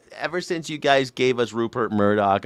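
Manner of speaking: ordinary talk, nothing marked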